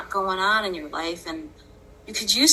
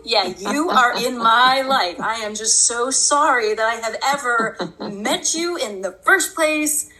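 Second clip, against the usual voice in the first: sarcastic rage; 4 semitones higher than usual. Retold or imitated. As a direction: spit out each sorry as an accusation. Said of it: "I was getting really sarcastic with it one day".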